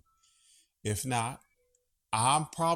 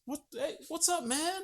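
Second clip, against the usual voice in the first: cat greeting; 4 dB quieter than usual. Real, not acted.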